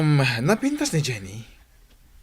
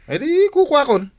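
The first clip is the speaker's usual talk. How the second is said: much higher and louder, a sudden excited cry; excited outburst; 12 semitones higher, 8 dB louder than usual. Acted. Teased by his pal about love, an excited high outcry. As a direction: burst out as if caught off guard. Teasing exchange between friends.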